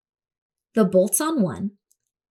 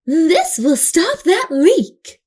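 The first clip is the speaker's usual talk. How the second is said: higher, louder, slow character voice; pleased neighbour; 6 semitones higher, 7 dB louder, slower than usual. Acted. Another neighbour grabs a piece, loud and satisfied. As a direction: savour the useful find. Passers-by take her discarded things.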